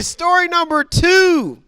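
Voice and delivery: high, slow showman call; announcer. Acted. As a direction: announce it like a game show host. Host announces story.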